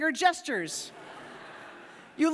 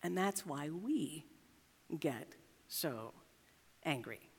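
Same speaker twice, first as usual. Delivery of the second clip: much softer, lower, slowed-down landing; hushed; 9 semitones lower, 11 dB quieter, slower than usual. Acted. Pastor to congregation, dropping to a hush on the point. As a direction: let the point sink in quietly.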